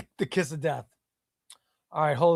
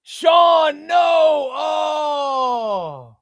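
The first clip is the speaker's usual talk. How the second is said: much higher, louder cry of no; surprised shout; 8 semitones higher, 6 dB louder, much slower than usual. Real, not acted. Streamer reacting as a viewer is knocked out, high surprised shout. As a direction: yelp in surprise at the result.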